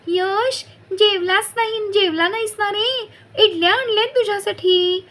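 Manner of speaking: high, fussing sing-song voice; doting aunt. A narrator's reading. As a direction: coax the child fondly. Neighbour fussing over child.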